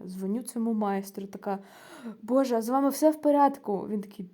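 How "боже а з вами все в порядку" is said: loud, higher-pitched worried question; frightened. Retold or imitated. Scared caller phones the repairman to check on him, alarmed. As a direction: blurt it out in retold alarm. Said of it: "Я дуже перелякалася".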